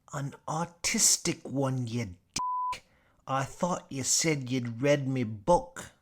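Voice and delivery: put-on Tasmanian accent. Gadsby snapping at Mitchell, in a broad Tasmanian accent. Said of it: "Tasmanian accent sounds almost like a Cockney accent".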